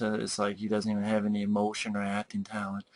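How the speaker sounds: lightly voiced flat, emotionless delivery; soft and lifeless, no acting at all; a hint of a dull, toneless actor; monotone actor. Shown as a demonstration. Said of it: "kinda talks like this".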